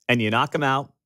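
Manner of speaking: normal talking voice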